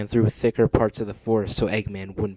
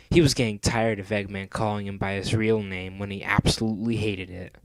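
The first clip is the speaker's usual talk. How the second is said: ordinary delivery, nothing marked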